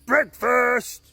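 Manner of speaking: high, loud call up. Acted. Caveman calling breakfast up to his family's treehouse. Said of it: "he called out".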